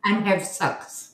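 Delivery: playful drawn-out Kiwi vowels; mock Kiwi accent.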